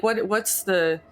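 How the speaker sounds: ordinary talk, nothing marked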